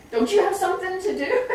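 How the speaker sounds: laughing mock question to idle staff; joking at staff who never sit still; teasing laugh. Staff told to slow down.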